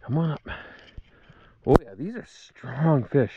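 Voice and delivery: ordinary talk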